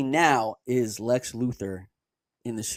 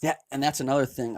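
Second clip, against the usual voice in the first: normal talking voice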